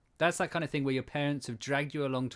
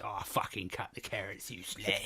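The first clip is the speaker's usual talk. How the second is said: lower, softer mock East End voice; 4 semitones lower, 8 dB quieter than usual. Retelling kitchen talk with Yasmin, low East End accent. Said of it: "I say that to Yasmin all the time".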